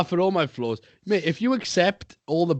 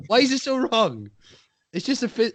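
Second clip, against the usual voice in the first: ordinary talk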